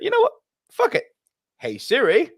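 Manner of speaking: thin high-pitched mock despair at the phone; squeaky mock exasperation. Telling his co-host how he gave up and asked Siri, high squeak. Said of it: "And I was like".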